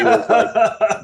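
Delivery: can't hold back a laugh as he starts. Real, not acted. Telling a friend about his buddy, laughing.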